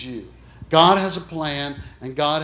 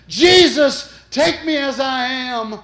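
high, loud cry then slower plea; desperate cry; 10 semitones higher, 8 dB louder, slower than usual. Retold or imitated. Dying woman cries out to Jesus, desperate and high. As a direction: call out to Jesus with hands raised. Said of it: "she reached her hands in the air".

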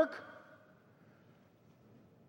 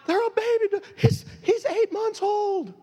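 incredulous; 10 semitones higher than usual. Retold or imitated. Incredulous reply to the yeller.

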